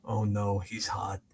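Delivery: breathy whisper of a crush. Retold or imitated. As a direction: whisper it like a guilty secret. Whispered inner thought.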